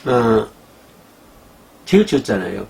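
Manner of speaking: ordinary talk, nothing marked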